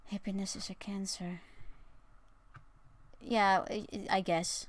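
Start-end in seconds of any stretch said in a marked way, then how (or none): 0.1-1.4 hushed unvoiced whisper. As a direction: breathe it as a whisper. Reading chat line in whisper.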